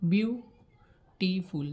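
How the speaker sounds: Beautiful very slowly, split as বিউ-টি-ফুল; broken into syllables. Shown as a demonstration. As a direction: pause between each syllable of beautiful. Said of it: "ভেঙে ভেঙে উচ্চারণ করতে হচ্ছে".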